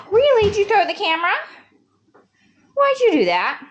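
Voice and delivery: high, loud disbelief; gasp, then scold; high shocked scolding. Real, not acted. Child threw the camera.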